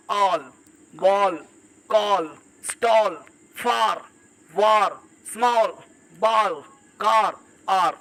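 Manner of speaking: all, ball, car one by one with a long, falling AA; open A sound. Shown as a demonstration. Teacher modelling letter A as AA, slow word drill. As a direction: stretch the vowel in all, call, stall, pausing between words. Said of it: "इसको आ पढ़ते हैं".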